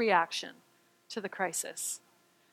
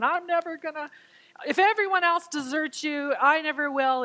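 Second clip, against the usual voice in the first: boastful Peter; 5 semitones higher than usual. Retold or imitated. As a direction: promise with cocky certainty. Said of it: "like, going".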